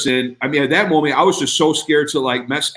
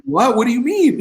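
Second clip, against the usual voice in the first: surprised; 10 semitones higher than usual. Retold or imitated. Reacting to surprise money.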